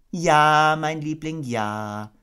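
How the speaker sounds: high, softening motherly voice; calm the little one down; soothing Kanga. Acted. Kanga humouring her baby, soothing. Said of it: "sagte Kenga beruhigend".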